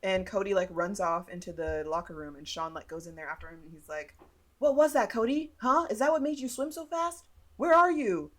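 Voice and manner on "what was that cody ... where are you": loud, high-pitched retold interrogation; mock confrontation. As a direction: call after him, acting out an accusing rival. Retold boy chasing and challenging Cody.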